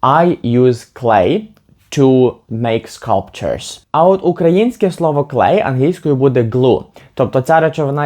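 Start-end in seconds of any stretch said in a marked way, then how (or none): 0.0-3.8 very slow, clear model sentence; deliberate classroom read; slow teaching example. Shown as a demonstration. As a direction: lay each word out for learners. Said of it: "ви скажете".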